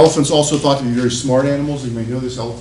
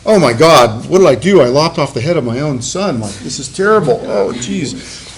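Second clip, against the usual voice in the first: mock horrified; 8 dB louder than usual. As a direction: wail in hammed-up horror. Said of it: "And she is like".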